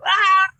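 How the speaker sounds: high, loud cathartic yell; screaming to blow off steam; frustrated yell. Retold or imitated. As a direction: let out a raw scream of frustration. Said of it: "he's just getting it out".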